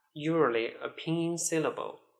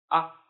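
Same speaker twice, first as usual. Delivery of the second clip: "Ah" cut short, with no glide; a brief, unstressed "Ah"; neutral tone; 4 dB louder than usual. Shown as a demonstration. Teacher demonstrates neutral tone, short "Ah". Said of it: "Let's read them one more time".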